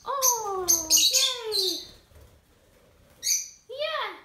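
high cooing. Real, not acted. Owner asking the birds if they want to play, high and cooing. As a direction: pitch up and coo at the birds.

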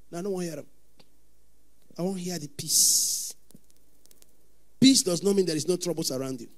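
ordinary talk, nothing marked

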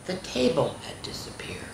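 spooky whispered reveal. A narrator's reading. Narrator whispers to the children about the vanished table. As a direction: hush it like a secret. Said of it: "whispered reveal".

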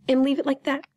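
ordinary talk, nothing marked

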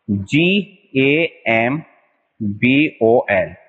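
letters spelled out very slowly; slow spelling. Shown as a demonstration. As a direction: spell each letter for the class. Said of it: "teacher spells the word". (अक्षर-अक्षर धीमे बताना)

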